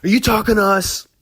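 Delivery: high, loud menacing challenge; mock-threatening Hell's Angel growl into the mic; menacing biker. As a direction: square up to the stage like a biker. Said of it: "is like".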